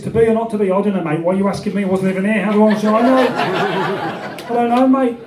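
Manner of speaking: put-on broad Midlands accent, baffled and defensive; Shakespeare. The real Shakespeare dodging questions, Midlands accent. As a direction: deliver it as a clueless Midlander in local accent. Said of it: "the real Shakespeare would have been more like this".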